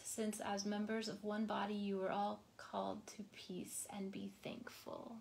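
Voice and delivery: breathy whispered close. Acted. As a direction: let the phrase fade into a whisper. Soft close of scripture quote.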